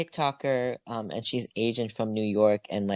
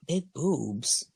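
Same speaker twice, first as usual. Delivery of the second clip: imitating [name]; 7 semitones higher, much slower than usual. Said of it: "and she said".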